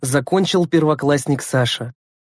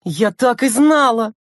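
higher, drawn-out exclamation; angry cry; 8 semitones higher, slower than usual. A narrator's reading. Lena learns who brought sweets, crying out. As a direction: cry out in exasperation. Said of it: "вскричала Лена".

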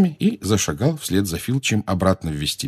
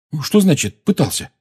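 quick-reacting question, pitched up; immediate question; 9 semitones higher, 3 dB louder than usual. A narrator's reading. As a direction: jump straight in with the question, higher. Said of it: "немедленно спросил Рон". (немедленный вопрос, повыше)

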